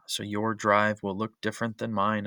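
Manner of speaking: usual speaking voice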